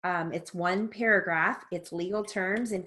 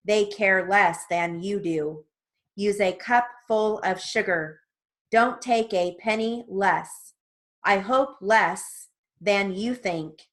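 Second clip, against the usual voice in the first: slow, even reading of practice sentences; dictation drill reading; 3 semitones higher, 7 dB louder, slower than usual. Shown as a demonstration. Reading practice sentences slowly to transcription students. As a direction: read each sentence out slowly for the class to take down.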